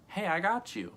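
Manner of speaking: high, breezy "Hey, I got you"; casual bro greeting. Retold or imitated. To a stranger in the store, offhand casual. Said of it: "Like, I didn't say".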